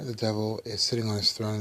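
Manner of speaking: ordinary talk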